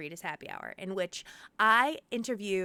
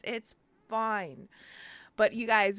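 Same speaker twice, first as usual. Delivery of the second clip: normal talking voice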